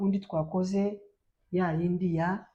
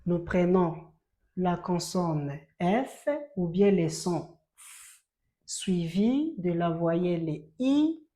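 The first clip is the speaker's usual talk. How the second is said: usual speaking voice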